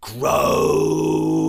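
loud high frog croak; big croak. Acted. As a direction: blow a great croak. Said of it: "he blew a great croak".

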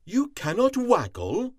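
Cat can't believe it; shocked cat voice; stunned cat. A narrator's reading. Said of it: "Cat was stunned".

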